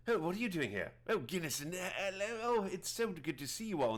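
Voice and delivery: high-pitched hermit druid, delighted; surprised, happy greeting in a put-on high voice; Prattis. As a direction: greet old friends as an eccentric hermit. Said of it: "I don't remember his accent".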